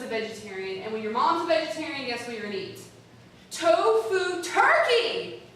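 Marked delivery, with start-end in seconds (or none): 3.5-5.4 high, loud and slow announcement; dramatic reveal. Acted. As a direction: slowly unveil it like a grim prize. Dramatic reveal of the bad dish.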